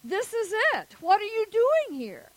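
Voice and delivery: high, loud retold demand; insistent questioning. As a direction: imitate an exasperated official demanding an answer. Retold man repeats his challenge.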